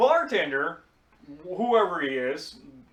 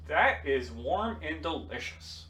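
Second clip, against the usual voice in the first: slow, savoring each word; slow appreciative tasting remark; 4 dB quieter, slower than usual. Retold or imitated. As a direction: relish it slowly, lightly. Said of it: "I said".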